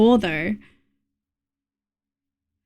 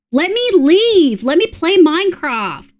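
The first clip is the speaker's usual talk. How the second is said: mock whiny plea; 5 semitones higher, 3 dB louder, slower than usual. Real, not acted.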